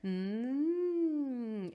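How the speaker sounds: hummed glide up high and back down; vocal siren. Shown as a demonstration. Teacher showing the warm-up exercise, hummed siren. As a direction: hum one long smooth siren swoop. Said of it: "your siren".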